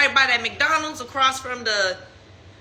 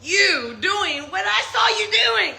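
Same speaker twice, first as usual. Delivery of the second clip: pitched-up disbelief at his lies; higher, exasperated retold confrontation; retold outrage; 7 semitones higher than usual. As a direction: tell him he's crazy, high and incredulous. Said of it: "And I was like".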